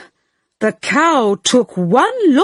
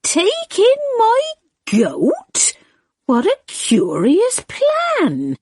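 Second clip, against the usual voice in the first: put-on higher, doubtful little old woman; puzzled old lady; 10 semitones higher than usual. A narrator's reading. Old lady doubts the wise man's advice, higher and puzzled. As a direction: question it in disbelief. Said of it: "said the wise old man".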